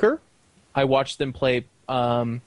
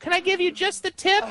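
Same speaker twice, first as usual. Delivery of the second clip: Lumpy Space Princess. Retold or imitated. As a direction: pitch way up like a cartoon princess. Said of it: "Was that the Lumpy Space Princess voice?".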